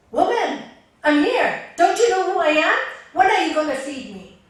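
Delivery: puffed-up monk shouting at the woman for food; arrogant demand. Acted. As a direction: bark at her like a self-important monk.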